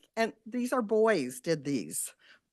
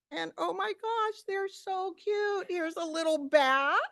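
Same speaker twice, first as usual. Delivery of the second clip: cooing with delight at the crafts; delighted gush; 8 semitones higher than usual. Real, not acted. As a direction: melt over how cute they are. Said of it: "delighted by cute projects".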